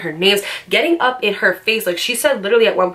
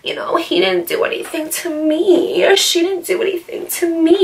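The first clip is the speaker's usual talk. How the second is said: mocking fake friend; 9 semitones higher than usual. Vlogger imitates friends who won't take sides, mocking and high-pitched. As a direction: mimic a two-faced friend making excuses, pitched up. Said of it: "one of those friends that's like".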